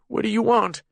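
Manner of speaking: put-on high, frightened pleading old man voice. A narrator's reading. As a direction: beg weakly, heart straining. Scared prisoner asks.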